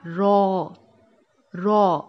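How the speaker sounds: رو said full-mouthed and thick, with the tongue sides on the upper molars; heavy Arabic ra. Shown as a demonstration. Teacher shows the heavy Arabic رو to students, full-mouthed. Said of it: "اس طرح بھر کے پڑھا جاتا ہے".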